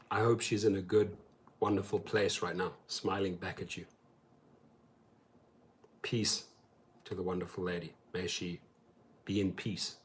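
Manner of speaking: very soft, low, solemn blessing; gentle condolence. Real, not acted. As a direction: quietly wish peace upon the departed. Condolence for friend's late mother.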